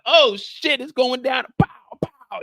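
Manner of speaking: high, loud, hyped outburst; excited alarm. Retold or imitated. Brian outside hearing gunfire, high excited yelp. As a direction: jolt up at the commotion.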